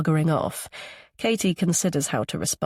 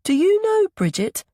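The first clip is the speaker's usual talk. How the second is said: put-on very high child character voice; small boy's high voice; 14 semitones higher, 5 dB louder than usual. A narrator's reading. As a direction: pipe up like a little boy. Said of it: "Milo's high voice".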